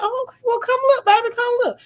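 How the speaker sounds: high, sugary coaxing voice, all sweetness; high sweet invitation. Retold or imitated. Inviting her man to watch the live, high sugary coaxing. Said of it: "I said".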